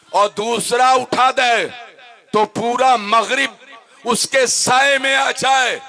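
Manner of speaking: shouted, high sermon climax; preaching at full force. Acted. Preacher dramatizing the revelation to listeners, shouted at full force. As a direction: thunder it out to the congregation. Preacher describes Jibril's might.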